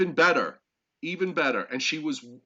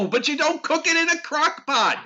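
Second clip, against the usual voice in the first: high, loud outraged cry; outraged shout; 13 semitones higher, 6 dB louder than usual. Real, not acted. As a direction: protest in mock horror. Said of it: "I knew you'd have that reaction".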